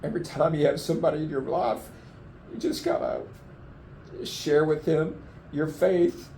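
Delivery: real choked-up voice, breaking in places; getting emotional. Real, not acted. Flood survivor urges sharing your faith, choked with emotion. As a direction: speak haltingly through welling emotion. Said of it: "I don't know why I'm getting so emotional".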